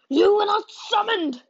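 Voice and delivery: high, loud shaking outcry; trembling shout. A narrator's reading. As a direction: cry out, voice trembling. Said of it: "My father's voice trembled".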